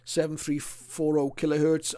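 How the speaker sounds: usual speaking voice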